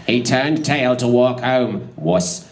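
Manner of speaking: ordinary talk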